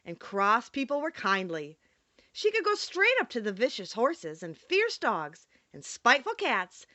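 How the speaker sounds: plain reading voice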